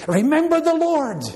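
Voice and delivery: high ringing rallying cry; preacher calling the flock to battle. Acted. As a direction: shout it like a war cry for the faithful. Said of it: "That ought to be our battle cry".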